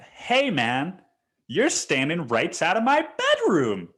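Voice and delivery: high, indignant re-enacted callout; mock-outraged complaint; indignant confrontation. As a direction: confront him with pitch pushed up.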